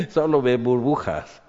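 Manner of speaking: speaks the line through a laugh; laughing at his own drowning joke. Real, not acted. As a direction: chuckle while saying it. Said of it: "laughs at own joke".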